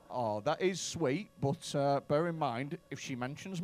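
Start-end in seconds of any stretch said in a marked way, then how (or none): none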